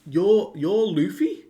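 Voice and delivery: half-acted astonishment at meeting someone famous; imitating a startled giant recognising Luffy; surprised gasp. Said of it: "Goldberg and Gerd are like".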